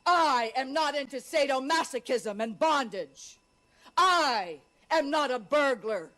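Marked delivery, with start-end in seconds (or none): none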